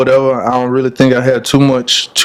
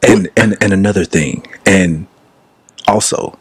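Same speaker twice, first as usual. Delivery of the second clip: lightly mocked pundit, pitched down; Stephen A. Smith; 6 semitones lower than usual. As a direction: mimic a friend putting on a low debate-show voice. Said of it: "talking like he's Stephen A".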